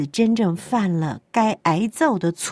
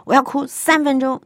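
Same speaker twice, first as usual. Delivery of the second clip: put-on high, raised little child's choice; lightly voiced small child; 7 semitones higher, 3 dB louder than usual. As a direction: say it like a small kid deciding. Child choosing.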